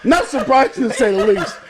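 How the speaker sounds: teasing weather-anchor impression; mock weather report, laughing; newscaster voice.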